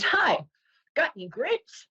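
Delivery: high, perky little duck voice; duck in a joke. Acted. Duck asking the bartender, high and innocent. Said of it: "He says".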